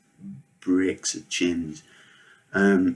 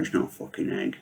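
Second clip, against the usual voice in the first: hoarse low scoff; 11 semitones lower, 7 dB quieter than usual. Retold or imitated. Kid denies it is an egg.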